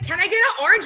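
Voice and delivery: high, loud pleading ask; high loud whiny request for an orange; mock whiny customer. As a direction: beg for fruit like a tipsy customer. Re-enacting demanding customer.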